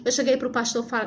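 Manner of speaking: ordinary talk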